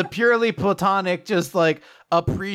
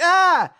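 startled yelp; 12 semitones higher, 6 dB louder than usual. Retold or imitated. Girl yelps during stretch.

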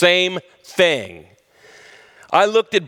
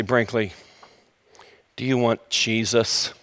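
suspicious hush; 8 semitones lower, 5 dB quieter than usual. Retold or imitated. Suspicious test of the dog.

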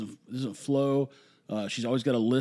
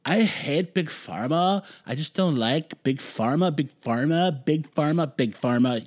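slow, whiny mock complaint; mock the Big Pharma haters, dragging it out; sarcastic parody of a protester; slower than usual. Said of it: "people would run around saying".

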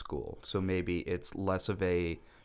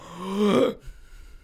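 sharp gasp, higher; mock horrified gasp; 11 semitones higher, 4 dB louder than usual. Facebook shows an old post, mock horrified gasp. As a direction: gasp at an embarrassing memory.

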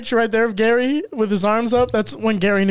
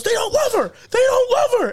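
upset dad. Retold or imitated. Dad upset about the video, high emotional mock yell. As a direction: cry it out like a wounded father. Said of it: "he goes".

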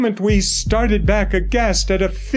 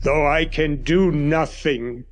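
lower, measured doctor's voice; grave old doctor; 4 semitones lower than usual. A narrator's reading. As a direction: speak as the stern family doctor.

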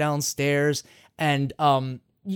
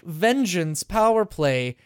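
ordinary delivery

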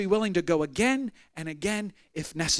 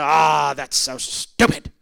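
put-on scoffing old man; 6 semitones lower, 3 dB louder, slower than usual. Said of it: "He'd go".

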